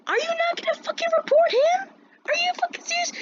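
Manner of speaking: very high, squeaky disbelief; incredulous outrage. Retold or imitated. Student silently judging teacher's inaction, hammed-up incredulous squeal. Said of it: "gave her this look like".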